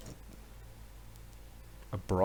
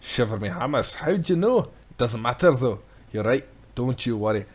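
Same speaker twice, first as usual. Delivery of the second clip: loud, hammy Scottish sea-dog; booming pirate smith; 6 dB louder than usual. Acted. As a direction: bellow it like a proud old sailor. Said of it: "I'm having a little bit more fun than I should".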